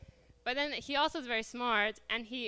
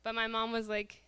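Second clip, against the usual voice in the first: normal talking voice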